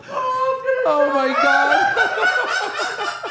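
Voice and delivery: high, shouted through laughter; laughing. Real, not acted. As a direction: howl it while cracking up. Cracking up at the meme.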